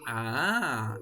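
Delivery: normal talking voice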